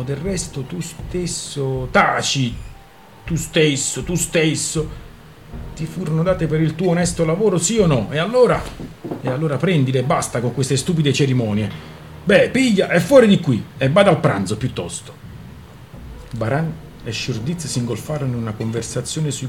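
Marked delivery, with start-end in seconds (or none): 0.6-1.9 slow, faltering words; hesitant. Acted. Daughter timidly answering her angry father, slow and hesitant. 1.9-2.5 sharp, loud command to be silent; angry. Acted. Father silencing his daughter, loud angry command. 3.2-5.0 angry mimicry. Father angrily echoing his daughter's words. 5.7-15.1 loud, scolding father; angry scolding. Acted. Father scolding his daughter over the returned shoes, loud and angry.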